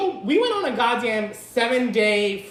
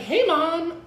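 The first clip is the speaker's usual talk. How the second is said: retold greeting; 7 semitones higher than usual. Voices self greeting mom in story.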